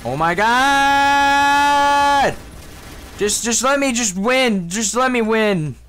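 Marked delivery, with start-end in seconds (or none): 0.0-2.4 long, high-pitched drawn-out scream; real gamer scream; screamed exasperation. Real, not acted. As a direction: stretch each word into a sustained high wail. Screaming over losing in game.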